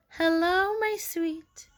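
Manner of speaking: high, sweet little greeting; cooing. A narrator's reading. Frogalina greeting her pie pumpkin, high cooing. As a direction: coo it lovingly, up high.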